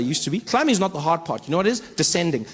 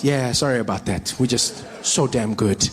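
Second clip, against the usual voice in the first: smug official; 8 semitones lower than usual. Retold or imitated.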